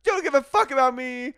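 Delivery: high, loud hammed-up wail; mock fury. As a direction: whine at the betrayal. Mock diva fan shout-outs.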